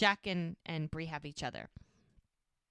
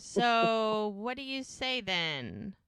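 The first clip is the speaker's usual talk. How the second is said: slow drawn-out question; 4 semitones higher, 5 dB louder, much slower than usual. Retold or imitated. Retelling his question to her.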